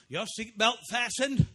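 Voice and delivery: put-on Maine-accented billboard question; Maine humor voice. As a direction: draw it out like a Maine local. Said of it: "with a Maine humor kind of voice".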